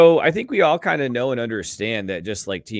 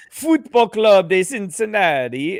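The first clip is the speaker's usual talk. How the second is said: slow, higher, louder mock Italian accent; 8 semitones higher, 5 dB louder, slower than usual. Host dodging the British request, broad mock Italian accent. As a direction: stretch it out in a hammed-up Italian accent. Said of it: "Italian for you".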